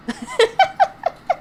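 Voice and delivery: a short, very high giggle; high laugh. Real, not acted. Laughing while arguing about show.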